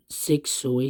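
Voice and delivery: voiceless whisper from a sore throat; lost voice whisper. Real, not acted. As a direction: whisper it, voice gone. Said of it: "still have many troubles with my voice".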